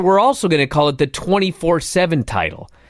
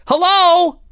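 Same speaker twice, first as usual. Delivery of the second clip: a sudden high, loud, fed-up shout into the void; exasperated yell; 12 semitones higher, 5 dB louder than usual. Real, not acted. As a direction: throw it out like nobody's listening, loud and high. Exasperated rant at WWE booking.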